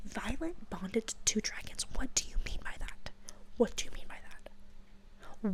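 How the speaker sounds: hushed, incredulous whisper; shocked whisper. Retold or imitated. Riders stunned that Violet has two dragons, whispering. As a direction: whisper it in disbelief.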